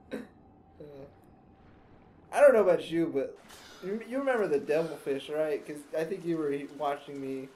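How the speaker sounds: ordinary delivery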